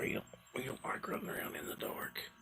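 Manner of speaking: soft whispered comment. Real, not acted. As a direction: whisper it to the viewers. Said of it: "whispered aside".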